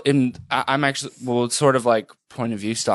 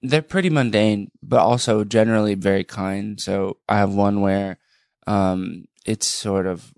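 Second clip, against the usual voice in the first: usual speaking voice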